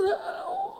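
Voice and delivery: very high squeaky refusal; laughing squeaky old woman; squeaky old lady. Retold or imitated. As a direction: squeak out a coy no.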